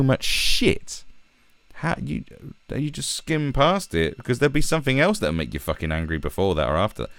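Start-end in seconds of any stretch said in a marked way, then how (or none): none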